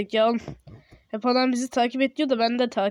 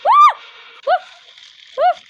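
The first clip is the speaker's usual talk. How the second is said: very high, loud scream; short repeated frightened shrieks; scream of fright; 12 dB louder, slower than usual. Real, not acted. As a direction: scream at a jump scare. Jump scare.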